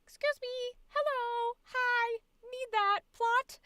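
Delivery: put-on high, cutesy anime girl. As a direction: squeak it out like a cartoon girl. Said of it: "anime girl being like".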